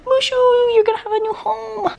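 high sing-song pet voice. Real, not acted. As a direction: coo at the puppy. Talking to the puppy.

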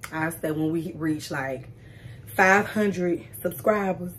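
ordinary delivery

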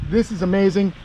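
ordinary talk, nothing marked

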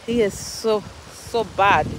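ordinary delivery

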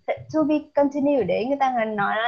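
normal talking voice

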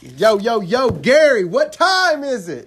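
loud, high hype call-out; rally the crowd. Real, not acted.

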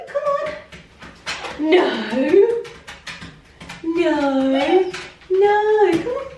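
very high pleading coax. Real, not acted. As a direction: coax the puppy back, high.

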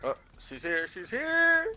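rising to a panicked shriek; panicked alarm. Real, not acted. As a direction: call the alarm, voice shooting up. Killer suddenly appears.

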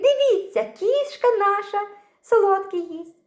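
high, loud excited cry; excited child shout. A narrator's reading. Little boy crying out to the guests, high and loud. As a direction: point and call out to the whole table. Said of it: "гукнув Петрик".

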